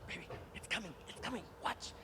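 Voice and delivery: hushed aside during a screening; excited whisper. Retold or imitated. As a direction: whisper eagerly to your girlfriend in the cinema.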